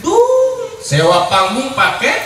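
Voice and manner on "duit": squeaky high punchline. Real, not acted. Punchline on money.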